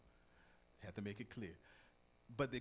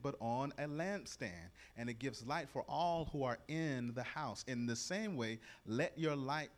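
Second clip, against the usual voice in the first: louder, measured Bible reading; scripture reading; 4 dB louder than usual. Acted. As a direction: proclaim the verse to the congregation. Reads scripture aloud.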